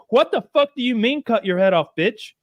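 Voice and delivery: high, incredulous rant; hammed-up exasperated tirade; outraged Englishman. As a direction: refuse in flabbergasted fury, acted. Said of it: "He's like".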